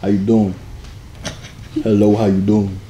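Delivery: very low, smooth-talk greeting; deep voice. Shown as a demonstration. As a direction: model a deep chest voice. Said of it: "Activate the deep voice".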